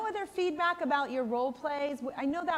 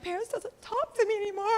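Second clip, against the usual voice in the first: higher, whiny mock-tearful grandma; imitating crying mom; 9 semitones higher than usual. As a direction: play the hurt grandmother, half-teary. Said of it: "You know, she's crying".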